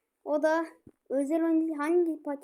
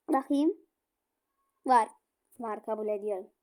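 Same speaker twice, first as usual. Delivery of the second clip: usual speaking voice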